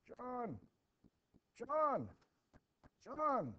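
high, loud shouts of a name; an old man bursting in, yelling for John; frantic calling. Acted. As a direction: call out desperately from outside.

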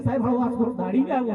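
low, softened polite request; affectionate asking. Shown as a demonstration. Parent politely raises a pupil's reading with teacher, gentle. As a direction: ask gently, voice dropped, easing into it. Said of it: "તો એને પ્રેમથી પૂછો કે".

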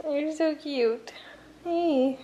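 slow, soft, tender coo; cooing at puppy. Real, not acted. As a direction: melt over the cute puppy.

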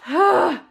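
high, breathy sigh; out-of-breath exhale; winded sigh. Real, not acted. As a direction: let out a puffed sigh. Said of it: "I'm so out of breath".

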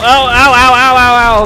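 loud high yelps of pain; pained ows. Real, not acted. Gamer getting hit, loud pained yelps. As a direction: yelp as the hits land.

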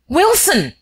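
high, loud cry of terror; sudden recognition in the dark, terrified; terrified shout. Acted. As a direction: shout the name in shock. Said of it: "he shouted in terror".

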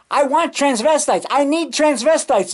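high, insistent phone demand; Ed Wood. Retold or imitated. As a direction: imitate a frantic director pleading by phone. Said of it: "Ed Wood's on the phone".

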